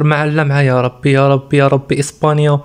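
normal talking voice

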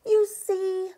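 ordinary delivery

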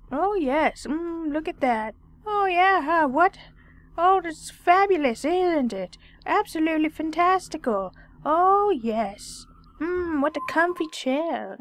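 high, exaggerated relish; mock delight. Player tempts Sitaro with a comfy chair, mock delight.

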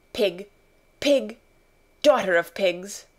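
put-on a child's angry name-calling through gritted teeth; angry muttered insults. A narrator's reading. Girl cursing her absent servant to herself, angrily. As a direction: spit out each insult, spaced apart. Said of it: "grinding her teeth and saying this".

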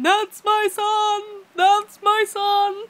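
high, slow, loud wailing father; grieving father. Retold or imitated. Retelling the Harry Potter father over his son, hammed-up wail. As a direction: wail it like a heartbroken dad in a film. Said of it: "father ... going".

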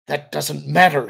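loud, forced-out denial; ground out. A narrator's reading. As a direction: grind it out in frustration. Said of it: "he ground out".

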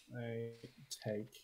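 usual speaking voice

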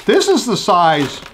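speaking between bites; talking while chewing. Real, not acted. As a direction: mouth partly full. Seen 1: Jaw and lip movement early on; later only holds curd near mouth.

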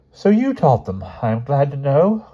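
high, loud schoolmistress; teacher's retort. A narrator's reading. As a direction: respond primly, pitched well up. Teacher answers boy's boast.

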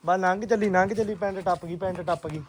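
usual speaking voice

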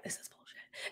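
hushed whisper of disbelief; whispered aside. Retold or imitated. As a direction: whisper it so nobody hears. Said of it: "And I know she's like".

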